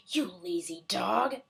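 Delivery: slow, seething witch voice; shouting witch. A narrator's reading. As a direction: drag it out at the dog. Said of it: "shouted Bony Legs".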